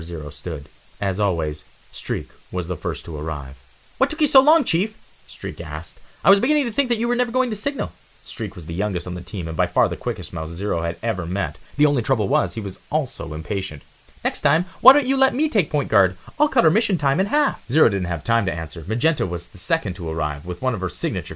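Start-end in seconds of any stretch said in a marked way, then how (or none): 4.0-5.0 high, quick and cocky; a restless kid who can't wait; high, impatient youngster; eager young mouse. Acted. 6.2-7.9 high, quick and cocky; a restless kid who can't wait; high, impatient youngster; eager young mouse. Acted. 14.2-17.6 high, quick and cocky; a restless kid who can't wait; high, impatient youngster; eager young mouse. Acted.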